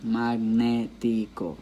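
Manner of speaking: Magnético drawn out slowly, leaning on "né"; slow teacher's demo of Magnético, second syllable stressed. Shown as a demonstration. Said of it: "you can hear that the stress is where".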